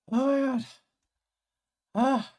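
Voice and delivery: breathless after the set; exhausted gasp. Real, not acted.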